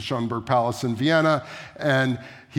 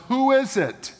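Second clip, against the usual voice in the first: high, sharp call through a closed door; a gatekeeper demanding who knocks; abbot calling out; 12 semitones higher than usual. Retold or imitated. Abbot challenging the herald at the door, high call.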